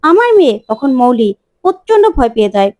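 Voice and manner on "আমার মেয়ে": higher insistent repeat. A narrator's reading. Nakul repeats his claim.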